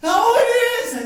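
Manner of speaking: slow, loud, amazed delight; joyful wonder. Acted. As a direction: let the joy swell slowly. Recognizes the family bowl.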